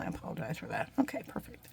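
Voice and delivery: soft unvoiced whisper right into the mic; whispered aside. Real, not acted. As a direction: breathe it out close, barely there.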